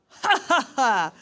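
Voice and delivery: high, loud fake laugh; forced laugh. Dad covering up at airport security, loud fake laugh. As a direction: laugh it off too loudly, hammed-up.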